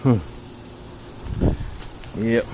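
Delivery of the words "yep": normal talking voice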